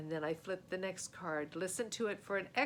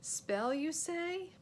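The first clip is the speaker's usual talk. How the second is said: surprised; 9 semitones higher, 3 dB louder, much slower than usual. Acted. Stalling, surprised.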